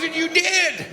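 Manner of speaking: very high mock exclamation; excited imitated punchline. Stranger telling the jumper that he did, excited high voice.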